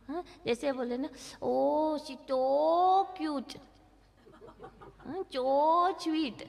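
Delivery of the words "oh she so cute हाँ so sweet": put-on drawn-out cooing over a baby; mock baby-talk gushing. Shown as a demonstration. Parent cooing baby talk at a toddler, drawn out. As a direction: coo at the baby in silly baby talk. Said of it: "ये नहीं बोलना".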